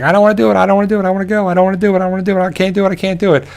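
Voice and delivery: put-on high whiny child voice, pleading; a frightened kid begging not to; imitating a scared nine-year-old refusing; scared little girl.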